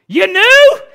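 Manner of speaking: shooting up high and loud, laughing in disbelief; surprised laugh. Retold or imitated. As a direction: blurt it out, caught off guard and laughing. Stunned at parents' reply.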